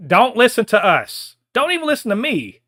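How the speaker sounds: loud, high, slowed insistence; emphatic shout. Real, not acted. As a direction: drive it home, almost yelling. Urging actor to ignore them.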